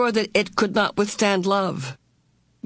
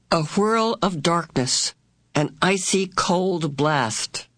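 usual speaking voice

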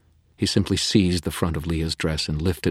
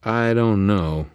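uncertain Susan; 6 semitones higher, 6 dB louder, slower than usual. A narrator's reading. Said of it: "Susan looked uncertain".

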